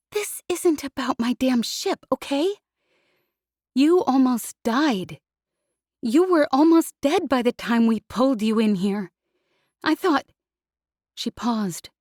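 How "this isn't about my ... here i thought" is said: angry through tears; scolding close to tears; tearful anger. Acted. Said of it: "horrified to see she was crying".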